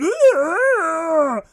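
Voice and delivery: loud, high running screech; piercing mimicked child's shriek; imitated kid's scream. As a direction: scream like a kid giving chase.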